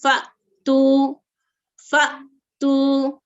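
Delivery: फ़तू very slow, with a small stop before तू; tiny jolt in the middle of फ़तू; slight catch on hamza. Shown as a demonstration. Teacher shows students the stop at hamza sakina. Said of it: "हल्का सा झटके की तरह".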